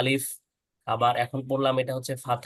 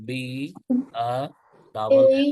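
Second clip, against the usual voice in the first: "বি, আ, double এল" letter by letter, with pauses; spelled out; 4 dB louder than usual. Shown as a demonstration. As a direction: break "বি, আ, double এল" into separate letters. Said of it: "এভাবে ভেঙে ভেঙে বলতে হবে".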